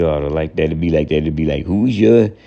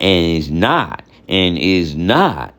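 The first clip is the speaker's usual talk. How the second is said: and is not slowed, with not leaned on and falling; stress on NOT; slower than usual. Shown as a demonstration. Teacher drilling the connecting words, slow and stressed. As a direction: drag out and is not, landing hard on not. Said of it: "and that's the connection".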